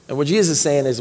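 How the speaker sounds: normal talking voice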